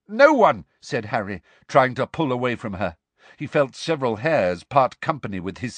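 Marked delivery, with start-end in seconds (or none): 0.1-0.6 high, loud retort; defiant denial. Acted. Harry denies it while pulling away, defiantly. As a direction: throw the denial straight back.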